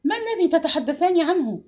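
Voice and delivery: high-pitched exclaimed question; crying out. A narrator's reading. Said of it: "صاحت دينا".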